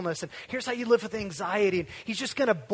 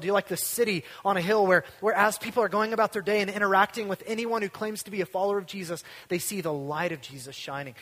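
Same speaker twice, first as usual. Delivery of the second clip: ordinary talk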